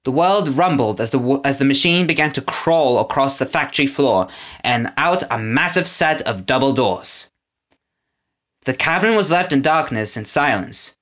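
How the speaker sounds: usual speaking voice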